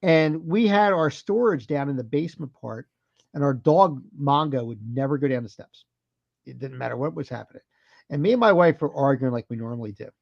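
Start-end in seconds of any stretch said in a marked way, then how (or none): none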